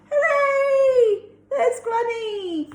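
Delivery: high, loud joyful cheer. A narrator's reading. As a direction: cheer out happily. Cheering Granny saved.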